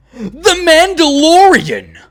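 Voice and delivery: high, loud, drawn-out announcer reveal; grand reveal. Pretend. Host introduces his number one, grand reveal.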